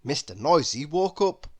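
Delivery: plain reading voice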